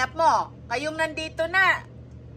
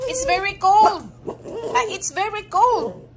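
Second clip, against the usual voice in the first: pet talk; 8 semitones higher, 3 dB louder than usual. Real, not acted. Cooing the same line at pets.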